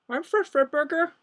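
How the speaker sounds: very high cartoon character voice; Fred Fredburger. Streamer imitates a cartoon character for viewers, high-pitched cartoon impression. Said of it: "a character in that called Fred Fredburger".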